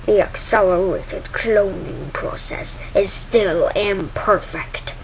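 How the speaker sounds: slow, measured villain voice; drawn-out, weighty pronouncement; Darth Vader. Acted. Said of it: "says Vader".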